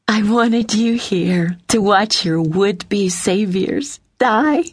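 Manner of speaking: sadistic gloating. A narrator's reading. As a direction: savor the threat, drawing it out. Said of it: "Her sadistic laughter".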